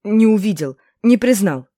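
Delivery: loud, hurried protest; panicked excuse. A narrator's reading. Guard excusing himself to inquisitor, loud and flustered. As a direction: blurt out excuses loudly.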